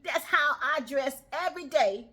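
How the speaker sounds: normal talking voice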